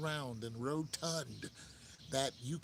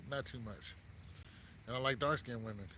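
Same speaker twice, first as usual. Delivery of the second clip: ordinary talk, nothing marked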